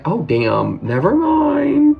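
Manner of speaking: mock-shocked retreat, last words drawn out high; imitating shock. Vlogger retelling her awkward moment to viewers, imitating shock. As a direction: act out being taken aback, ending high and slow. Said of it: "I was shocked".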